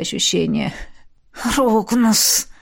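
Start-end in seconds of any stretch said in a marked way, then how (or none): 1.6-2.5 through teeth. A narrator's reading. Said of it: "процедил я".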